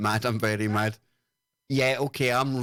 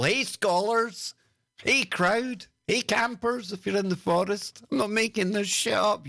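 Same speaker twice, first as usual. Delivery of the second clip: mock expert voice; 8 semitones higher than usual. Mocking the genius explaining words.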